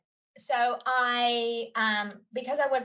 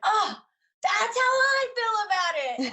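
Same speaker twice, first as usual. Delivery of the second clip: much higher, a light imitated gasp of recognition; mock delighted surprise; 12 semitones higher, 3 dB louder than usual. Teacher retelling her reply to husband's family, mock delighted surprise. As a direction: replay her own pleased surprise, a bit hammed-up. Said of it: "And I was like".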